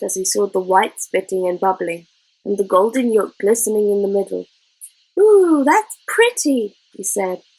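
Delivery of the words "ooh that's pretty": slow, higher gasp of wonder; boy amazed watching an egg fry, slow and higher; childlike awe. Acted. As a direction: draw out the ooh like a child seeing magic. Boy marvels at fried egg.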